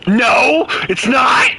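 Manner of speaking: high, loud protesting yell; high shout. Real, not acted. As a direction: yell it out in disbelief. Sudden protest while gaming.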